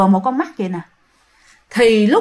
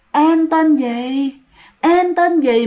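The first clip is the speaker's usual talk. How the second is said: slow, higher, hollow ghostly question; echoing ghost voice; 4 semitones higher, much slower than usual. Retold or imitated. Ghost asking Thi her name, slow and echoing. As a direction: let it ring out like a ghost's echo. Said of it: "cái vọng của nó vang, vang, vang".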